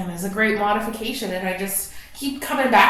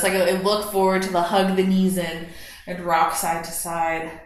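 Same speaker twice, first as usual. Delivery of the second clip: usual speaking voice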